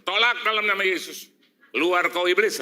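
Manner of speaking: loud, high rebuke of the devil; loud mock exorcism cry; rebuking shout. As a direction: bellow the command to drive the devil out. Casting out the devil.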